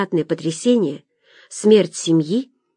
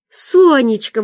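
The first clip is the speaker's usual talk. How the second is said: high, louder cry of recognition; exclaiming; 6 semitones higher, 5 dB louder, slower than usual. A narrator's reading. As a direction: exclaim the friend's name in surprise. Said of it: "воскликнула я". (удивлённое восклицание)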